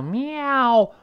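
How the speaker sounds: high, long miaow; cat's meow. Shown as a demonstration. Teacher likening wow's vowel to a cat, meowing. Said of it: "所以像猫叫一样".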